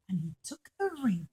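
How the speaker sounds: usual speaking voice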